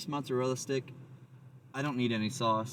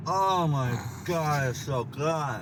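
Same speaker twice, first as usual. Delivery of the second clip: slow, loud, higher oh my God; hammed-up delight; 5 semitones higher, 5 dB louder, much slower than usual. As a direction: overplay the tasting for camera. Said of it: "I played it up for the camera".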